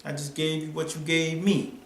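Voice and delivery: put-on laid-back Baltimore accent comeback. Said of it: "in that Baltimore accent".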